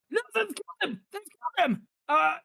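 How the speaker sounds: high frantic yell of a guard; panicked shout. Acted. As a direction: cry out in alarm. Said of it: "you hear him shout".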